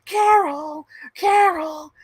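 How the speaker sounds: high, loud sitcom-style calling; quoting a sitcom, high loud calling; sitcom quote. Retold or imitated. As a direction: yell the name high like the show. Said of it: "Always Sunny in Philadelphia".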